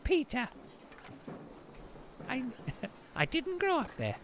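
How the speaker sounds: high-pitched imitation of mom, breaking into a laugh; mock exasperated mom. Streamer imitating mom correcting their assumption, high mock protest. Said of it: "and she was like".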